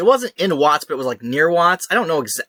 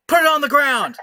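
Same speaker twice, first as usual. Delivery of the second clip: much higher mock cop yell; cop order; 11 semitones higher than usual. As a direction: shout the order sharply, as the cop. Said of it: "And they're like".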